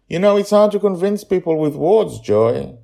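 put-on high, loud child's voice; a small boy telling a secret; little boy voice. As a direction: voice young Charlie, eager and bright. Child Charlie voiced in story.

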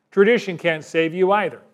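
ordinary delivery

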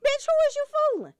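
fast, high and loud; fast high rant. Real, not acted. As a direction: fire it off, exasperated. Ranting at pie bringer.

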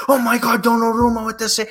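high-pitched imitation of an excited commentator; mock freak-out. Retelling how a commentator took a replay for live play, mock freak-out. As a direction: mimic the commentator losing it, pitch way up. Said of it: "he starts freaking out like".

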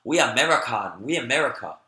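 put-on loud, insistent Chinese-accented broken English; singsong imitation of Chinese-accented English; imitated Chinese accent. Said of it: "I'm gonna impersonate their accent".

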